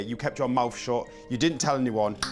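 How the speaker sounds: ordinary talk, nothing marked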